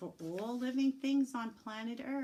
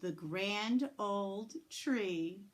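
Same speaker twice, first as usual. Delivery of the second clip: grand build; 2 semitones higher, 7 dB louder, slower than usual. Acted. Teacher reading story aloud, slow and grand. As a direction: make it sound majestic.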